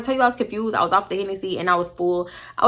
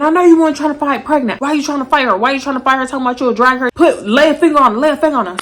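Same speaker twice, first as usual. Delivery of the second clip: high, loud imitated yelling; angry man screaming at girl who wanted to fight; retold screaming; 5 semitones higher, 7 dB louder, faster than usual. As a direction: scream at her in his voice. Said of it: "him screaming".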